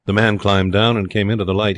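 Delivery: usual speaking voice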